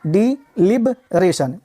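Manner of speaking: डिलिबरेशन drawn out slowly, di-li-bre-shun; slow modelling. Shown as a demonstration. Teacher slowly modelling डिलिबरेशन for students. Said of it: "तो डिलिब".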